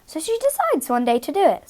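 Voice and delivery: usual speaking voice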